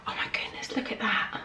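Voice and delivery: soft whispered wonder; awed whisper. Real, not acted. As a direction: hush it in awe. Whispered awe.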